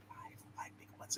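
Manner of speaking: imitating a hushed whispered aside. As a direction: drop to a whisper for the punchline. Quoting Bill Murray's line.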